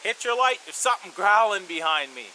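loud, high urgent call across camp; yelled warning. Retold or imitated. Calling to a sleeping friend in the dark, urgent loud yell. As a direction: shout it to wake your buddy. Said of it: "So I yelled over to my friend".

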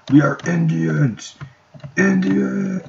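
loud, slow, rising chant; mock war cry. Player rallying his game team, mock war cry. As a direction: build to a high, held-out rallying cry.

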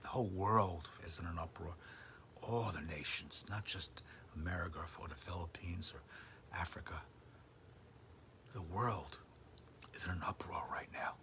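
low hushed whisper; intense whisper. Real, not acted. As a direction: lean in and whisper it. Preacher drops to whisper.

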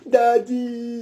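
put-on a child's whining plea for daddy; pleading child. Child pleads with father, whining. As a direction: whine it like a child wanting money.

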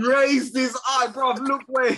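loud, high, laughing disbelief; incredulous echo. Real, not acted. Fan mocking his co-host's claim of a mere graze, incredulous. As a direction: throw it back loud and high, outraged.

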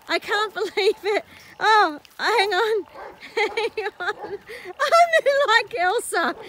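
usual speaking voice

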